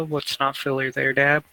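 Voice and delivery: ordinary delivery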